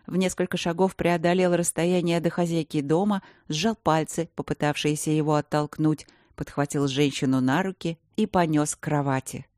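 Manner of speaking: usual speaking voice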